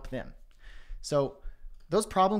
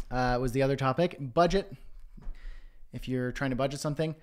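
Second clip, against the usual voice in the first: ordinary talk, nothing marked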